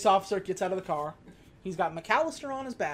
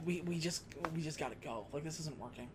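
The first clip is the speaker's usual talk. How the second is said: defeated mutter; 5 semitones lower, 15 dB quieter, faster than usual. Retold or imitated. Defeated husband giving up.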